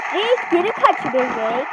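ordinary talk

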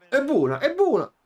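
high excited yell, rising to a shout. Real, not acted. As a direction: cry out at the open chance. Excited gamer sees chance.

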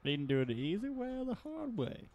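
high, put-on ogre voice; hammed-up cartoon ogre threat; Shrek. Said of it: "if it was actually Shrek's voice".

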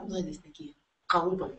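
hushed aside whisper. Real, not acted. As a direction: say it under your breath. Aside remark, unvoiced whisper.

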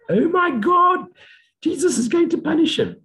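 high mock outrage from a pious viewer; mock pious commenter. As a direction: parody a scandalized believer.

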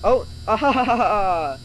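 mock evil laugh. Voicing pranksters after their trick, mock laughing. Voices the game's gloating laugh.

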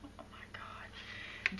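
slow, barely audible whisper; awed whisper. Real, not acted. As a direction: breathe it out slowly in disbelief. Awed at the card.

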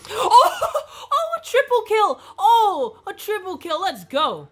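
high, loud triple kill whoop; excited cheering. Real, not acted. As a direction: cheer it like a big win.

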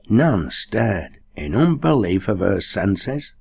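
ordinary delivery, nothing marked

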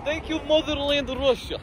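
put-on high, hammy Russian accent; hammy patriotic Russian. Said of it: "I have best Russian accent".

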